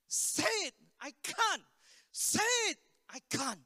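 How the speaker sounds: high, loud command against strained refusal; urgent back-and-forth. Retold or imitated. Pastor urges girl to say the name, retold urgent exchange. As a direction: act out both sides, punching each SAY IT.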